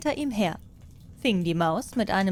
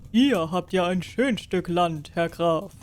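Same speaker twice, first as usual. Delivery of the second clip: lightly voiced king. King asks the peasants about the hay, a hint regal.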